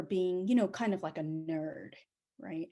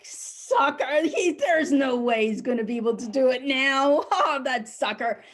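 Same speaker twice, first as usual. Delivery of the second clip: loud, raised mocking taunt with a laugh; jeering; 4 semitones higher, 6 dB louder than usual. Neighbor's landscapers watching him hit bedrock, jeering. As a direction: gloat at a rival's bad luck.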